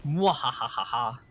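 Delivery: cackling villain laugh over the name; evil laugh. Acted. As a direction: let out a big evil cackle. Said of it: "Evil Laugh".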